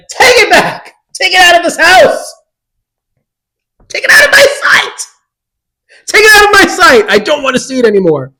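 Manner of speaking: high, shrieking mock outrage; hammed-up screaming. Daughter orders mom to return the e-reader, hammed-up shrieking. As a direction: scream it in over-the-top horror, then rush the last sentence. Acted outburst rejecting the gift.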